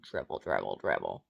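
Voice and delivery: suddenly very deep, silly repetition; playful low voice. Real, not acted. Dripping tea down her chin, playful very low mutter to herself. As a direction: drop way down for a comic aside.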